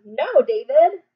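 loud slow scold. Shown as a demonstration. Teacher modelling a scold for the class. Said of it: "tell him".

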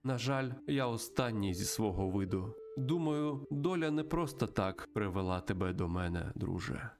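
measured, mystical storytelling voice; solemn spirit guide. Acted. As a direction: intone it like an ancient guide to a lost soul. Voices spirit guide Great Mountain. (урочистий голос духа-наставника)

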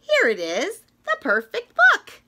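high, loud delighted squeal; excited. Acted. Reader finding the perfect book, delighted squeal.

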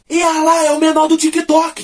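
put-on high, slow shouted call from a kid; child shouting. School kid yelling at the narrator pushing the car, loud and high. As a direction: yell it across the street like an excited kid. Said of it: "gritou assim".

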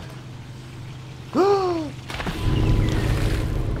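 sharp high unvoiced gasp of fright; startled gasp. Real, not acted. Gamer jolted by a jump scare, sharp frightened gasp. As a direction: gasp as if jumped at.

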